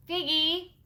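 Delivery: put-on high, loud childish call; eager husband calling. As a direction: call her excitedly, breaking her daydream. Husband interrupts her reverie, calling her.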